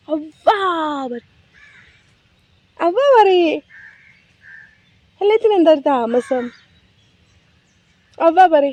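high playful coaxing. Real, not acted. Mother coaxing her baby to say bye, high baby talk.

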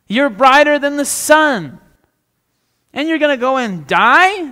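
a follower loudly protesting God's plan in disbelief; incredulous outburst. Retold or imitated. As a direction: cry out in disbelief at God. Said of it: "You might be incredulous yourself".

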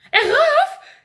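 very high, loud alarmed squeal; shocked shriek. Acted. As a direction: shriek in panic at the idea. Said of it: "gilt giraf".

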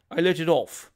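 mildly self-satisfied; understated boast of a proud shooter; conscious pride. Acted. Shooter owning his shot, conscious pride. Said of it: "with conscious pride".

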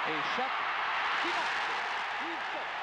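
excited sports commentary. Real, not acted. Hockey goal call on play-by-play.

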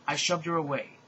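usual speaking voice

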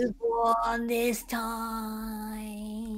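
very slow, soft ghost voice; breathy ghostly defeat, drawn out. Pretend. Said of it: "Go on, do your ghost voice".

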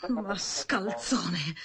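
slow, whispered "Mascalzone"; hissed insult. Acted. Woman cursing the thief under her breath, whispered. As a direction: breathe the insult out slowly with contempt.

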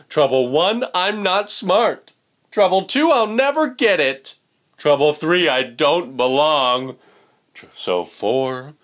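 loud, high mopey chant of woes; glum fish voice. Acted. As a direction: wail the troubles like a sulky little fish. Pout fish lists his troubles.

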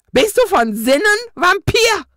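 high, loud shocked ghost cry; ghost calls out. A narrator's reading. Ghost appalled at the vampire reaching for the cross, loud high cry. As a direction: shriek it at the foolish vampire. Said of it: "rief Osmund Mortus Dämon".

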